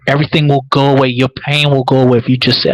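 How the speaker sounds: ordinary talk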